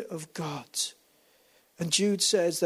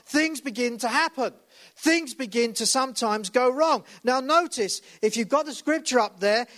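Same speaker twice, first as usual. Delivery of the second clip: full-force preaching; 7 semitones higher than usual. Real, not acted. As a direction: preach it out to the congregation. Preaching builds again.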